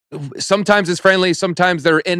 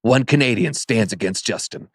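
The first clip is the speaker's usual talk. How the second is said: movie trailer voice; 4 semitones lower, 4 dB quieter than usual. Retold or imitated. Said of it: "that's my movie trailer voice".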